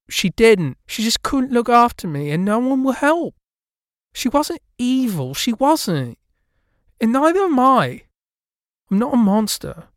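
high, loud and hurt; pleading ghost girl. A narrator's reading. Ghost girl pleading with the narrator, high and hurt. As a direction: insist you are not evil. Lydia pleading she is no monster.

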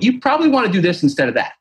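quick, high-pitched imitation of a helpful correction; mentor's correction. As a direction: quote a mentor's tip, high and hurried. Mimics a gentle correcting mentor.

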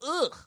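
high, loud groan of revulsion; disgusted "ugh". Retold or imitated. Disgust at retold proposition.

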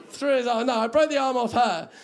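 ordinary talk, nothing marked

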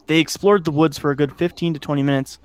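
ordinary delivery, nothing marked

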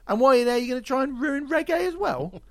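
high, loud mock-outrage; incredulous rant. Real, not acted. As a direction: pitch jumps high and loud in disbelief. Ranting at [name]'s reggae turn.

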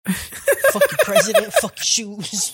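high, slow laughing rant; Riley impression. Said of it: "that episode with Riley".

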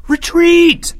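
high, loud shouted command; crying out. A narrator's reading. Leo calls his soldiers back, crying out. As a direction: shout it out to the troops. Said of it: "Leo cried out".

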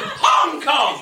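"Hong" shouted high, "Kong" dropped through laughter; mock stress shout. Shown as a demonstration. Mocking Americans, shouted stress on "Hong". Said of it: "but they still do".

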